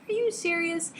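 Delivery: high-pitched mock disbelief; incredulous. Reader reacting to the guy whining, incredulous. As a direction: ask it as if appalled.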